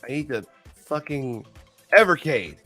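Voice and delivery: normal talking voice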